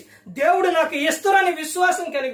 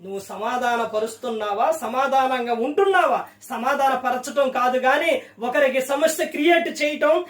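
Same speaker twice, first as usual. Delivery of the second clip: ordinary delivery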